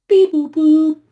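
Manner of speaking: high loud robot beeping; robot beeps. Retold or imitated. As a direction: beep it out like a machine. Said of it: "I am robot".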